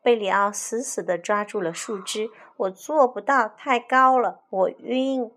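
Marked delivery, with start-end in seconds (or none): 2.6-5.3 scared whine. Acted. Berio afraid of height.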